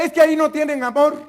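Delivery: loud whiny excuse. Acted. Ex-member answering the pastor, loud mock excuse. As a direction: protest that nobody loves you there.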